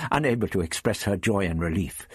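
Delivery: ordinary delivery, nothing marked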